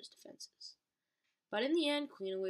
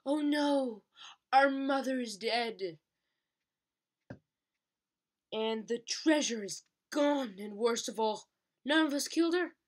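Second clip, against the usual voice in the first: hammed-up cry of grief, higher and louder; mock anguish; 5 semitones higher, 7 dB louder than usual. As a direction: wail over the fallen mother, overdone. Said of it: "let out a cry of anguish".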